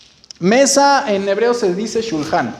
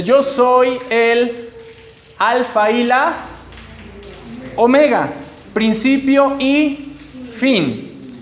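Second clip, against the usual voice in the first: slow, higher, drawn-out proclamation of the verse; solemn scripture reading; 3 semitones higher, much slower than usual. Acted. Teacher reading the verse to the class in a solemn, slow proclamation. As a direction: read the words of Jesus like a grand proclamation, stretching every phrase.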